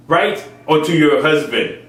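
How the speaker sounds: ordinary talk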